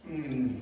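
low, soft drowsy hum; sleepy hum. Retold or imitated. Wife dropping back to sleep, soft low hum. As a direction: imitate a sleeper's quiet hum. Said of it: "all of a sudden she goes".

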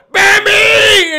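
high, loud holler at a deer; mock yell. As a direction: yell it out as a joke example. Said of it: "I'm not gonna yell".

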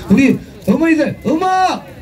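high, slow drawn-out call of 음악; calling out cue. Acted. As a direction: shout the cue long and high. Cueing the band.

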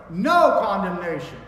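"No" hit very loud, then "condemnation" falls away; strongest stress on NO. Shown as a demonstration. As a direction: preach it with a booming "No" up front. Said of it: "the strongest way you can say no".

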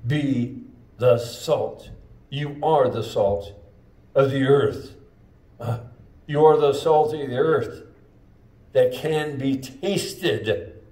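salt-of-the-earth preaching, rising in force. Acted. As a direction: build it like a preacher driving the point home. Preaching, building up.

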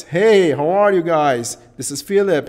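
loud, high excited greeting; hyped-up demo hello. Shown as a demonstration. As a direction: introduce yourself with big excitement. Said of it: "Excited voice".

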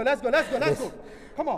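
ordinary delivery

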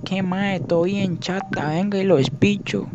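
high, slow streetwise Costa Rican drawl; barrio tico slang voice. Retold or imitated. Imitating the barrio guy for chat, in street Costa Rican accent. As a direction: put on a rough neighbourhood tico guy. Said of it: "el mae de barrio, que habla todo, todo raro".

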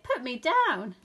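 loud, protesting order; indignant shout. A narrator's reading. Rabbit to Douglas, shouting to be released. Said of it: "shouted the rabbit".

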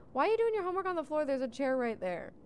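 fast, high, incredulous scolding; exasperated scolding. Real, not acted. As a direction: scold them in disbelief. Scolding sims for sitting on floor.

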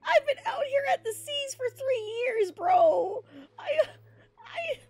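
high, hammed-up sobbing whine; mock crying plea. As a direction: wail it like a desperate, overdramatic beggar. Sailor begging for a share.

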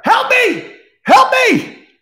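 very high, loud shrieks; doll screaming for help. Acted. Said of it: "Chucky's up there".

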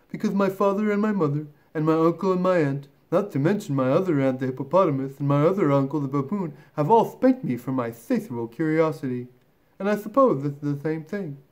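earnest little elephant voice; lightly voiced, chattering young elephant; young elephant child. Elephant child explaining his spankings to the python, earnest.

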